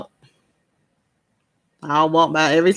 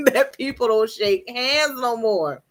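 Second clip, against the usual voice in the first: ordinary delivery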